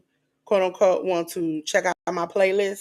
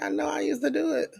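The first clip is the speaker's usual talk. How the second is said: quoted self; 8 semitones higher, 7 dB quieter, faster than usual. Retold or imitated. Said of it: "I was like".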